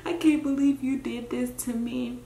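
normal talking voice

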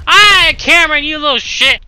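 loud, high-pitched outburst at a rival; exasperated gamer shout; angry yell. Real, not acted. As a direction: yell it at the player who got you. Yelling at a player in game.

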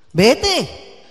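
normal talking voice

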